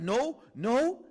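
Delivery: loud, high angry no; angry denial. Retold or imitated. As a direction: shout it down like a stung kid. Angry child denying.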